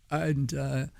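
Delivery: ordinary talk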